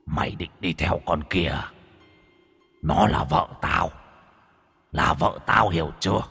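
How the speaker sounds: low, hushed spiteful ghost voice; dark whisper behind you. A narrator's reading. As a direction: breathe the threat like a ghost. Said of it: "giọng hằn học như từ cõi âm".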